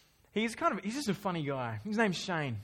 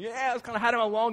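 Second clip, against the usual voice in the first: fast, louder, slightly put-on bloke voice; mate's cheeky voice; 2 semitones higher, 5 dB louder, faster than usual. Mate boasting about fooling clients, fast and cheeky. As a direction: rattle it off like a cheeky Aussie mate. Mate retelling his con.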